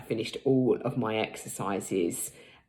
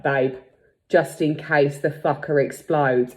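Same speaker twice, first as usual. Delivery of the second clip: louder, deadpan husband line; mock serious warning; 7 dB louder than usual. Husband in head torch warning wife, loud and deadpan. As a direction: deliver the joke deadpan and loud.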